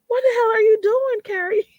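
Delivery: half-crying tease at her own name; mock self-scolding. Retelling scolding herself while crying at weddings, teary mock scold. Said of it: "gather my own darn self and be like".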